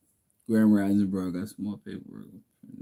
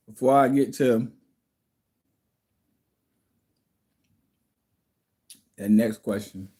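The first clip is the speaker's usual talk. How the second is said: talking while chewing; words around a bite of food; mouth full. Real, not acted. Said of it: "I don't like to speak with my mouth full".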